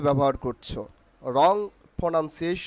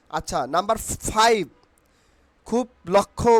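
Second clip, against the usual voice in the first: usual speaking voice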